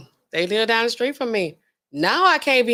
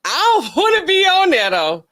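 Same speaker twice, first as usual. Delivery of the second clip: higher, louder, laughing through it; laughing protest; 9 semitones higher, 6 dB louder than usual. Retold or imitated. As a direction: laugh off the idea of jury duty. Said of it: "I be like".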